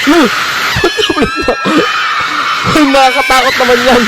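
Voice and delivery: very high startled yelp; shrill shocked reaction, then scared; startled shriek. Real, not acted. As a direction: squeal at the jump scare. Reacting to scream clip.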